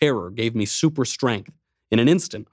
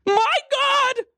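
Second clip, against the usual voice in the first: much higher, shocked woman's cry; young woman shrieking. Acted. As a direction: gasp it out high like a frightened woman. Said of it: "said the young woman".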